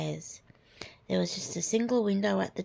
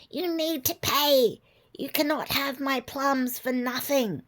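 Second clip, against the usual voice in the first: witch voice; 7 semitones higher, 3 dB louder than usual. Acted. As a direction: growl it out like a greedy witch. Witch demands payment.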